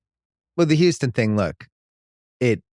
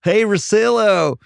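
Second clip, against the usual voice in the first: high, drawn-out Southern fan yell; Southern accent; 11 semitones higher, 4 dB louder, much slower than usual. Acted. Rockets fan telling off Russillo, hollered Southern accent. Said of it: "I should have added a Southern accent".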